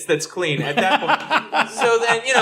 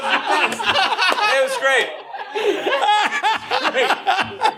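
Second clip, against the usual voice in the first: squeezed out through laughter; high, slow, laughing repeat; laughing too hard to talk; 11 semitones higher, much slower than usual. Real, not acted.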